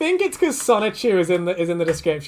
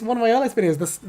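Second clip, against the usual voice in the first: ordinary talk, nothing marked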